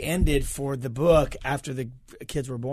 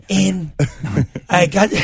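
half-hearted go at a dad's voice; imitating dad; 3 semitones higher, 5 dB louder, much slower than usual. Son trying dad reading his book, half-hearted imitation. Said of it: "my dad would be like".

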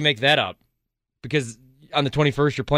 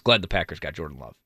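sarcastic; 9 semitones lower, 3 dB quieter than usual. Real, not acted. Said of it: "Hey, that was sarcasm".

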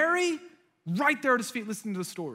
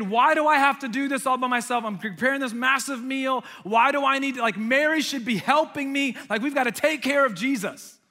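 raised, higher-pitched complaining; frustrated; 5 semitones higher, 5 dB louder than usual. Retold or imitated. Martha overworked while her sister sits, frustrated. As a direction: vent louder and higher, point after point. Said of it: "like, get frustrated".

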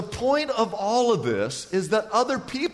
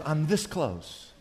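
soft confiding; 4 semitones lower, 7 dB quieter, slower than usual. Retold or imitated. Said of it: "She says".

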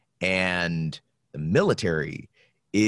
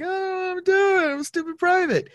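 mock flustered private. Private answering a yelling NCO, high mock whine. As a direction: play yourself as a hapless recruit. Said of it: "he's yelling at me and I'm like".

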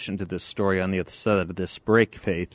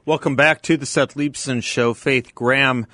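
loud host read to open the segment; show announcer; 3 semitones higher, 6 dB louder than usual. Acted. Host welcomes listeners back after the break, announcer read.

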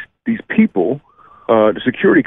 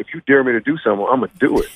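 normal talking voice

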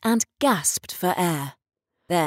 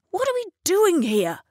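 put-on much higher, demanding old man; 12 semitones higher than usual. Restless resident Mister Lewis demands, high-pitched. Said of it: "his voice rising to a high pitch".

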